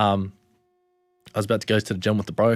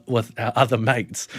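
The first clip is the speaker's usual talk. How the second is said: ordinary talk, nothing marked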